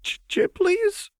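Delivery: high, slow stunned stammer; terrified servant echoing; dull echo. A narrator's reading. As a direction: repeat it in shock. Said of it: "he echoed dully".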